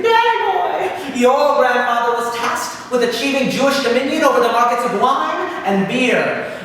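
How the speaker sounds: high, loud, ringing demon voice; booming Satan. Retold or imitated. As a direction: boom out a grand devilish greeting. Satan teases Dan.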